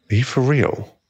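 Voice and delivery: usual speaking voice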